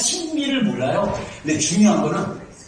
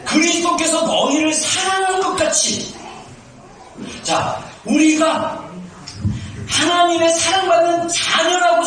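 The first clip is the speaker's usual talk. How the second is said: forceful preaching; 8 semitones higher than usual. Real, not acted. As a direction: drive the words out like a revival preacher.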